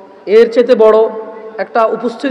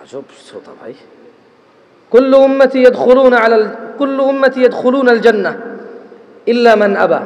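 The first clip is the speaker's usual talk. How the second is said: loud, measured sermon recitation with chanted Arabic; solemn preacher reciting scripture to the crowd; preaching a hadith; 3 dB louder, slower than usual. Acted. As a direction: intone the Arabic slowly, then preach the meaning.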